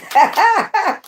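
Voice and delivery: very high cackling laugh. Real, not acted. Reader laughing at her own line with a high cackle. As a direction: let out a high, delighted cackle. Laughs after "they not like us.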